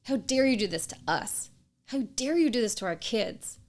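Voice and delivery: a betrayed wife screaming at her cheating husband; raging accusation. Retold or imitated. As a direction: hurl it at your husband in rage. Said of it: "in my rage and in my anger and in my hurt".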